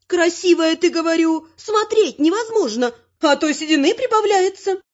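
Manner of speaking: high-pitched granny needling a rival; mock-polite granny, voice way up; unperturbed taunting granny. A narrator's reading. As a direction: tease on in a high old-lady voice. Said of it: "невозмутимо продолжала бабуля".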